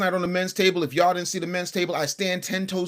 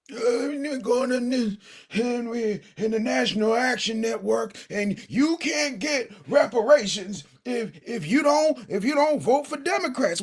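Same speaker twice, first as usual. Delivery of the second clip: Al Sharpton impression; 4 semitones higher than usual. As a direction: parody Al Sharpton's preachy lecture. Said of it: "Where's your boy Al Sharpton at?".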